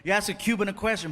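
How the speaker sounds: normal talking voice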